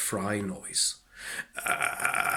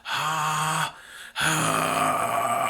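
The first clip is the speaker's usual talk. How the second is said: whisper scream; 5 semitones higher, 4 dB louder than usual. Shown as a demonstration. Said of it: "like it's going through a high pass filter".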